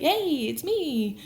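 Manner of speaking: high, drawn-out singsong greeting; mock sheepish reveal. Joseph revealing himself to his brothers, high and drawn out.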